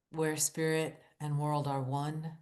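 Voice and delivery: ordinary talk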